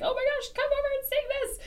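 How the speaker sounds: high, eager call to come sing; excited friends. Retold or imitated. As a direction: urge a friend to the mic, high and bright. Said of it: "be like".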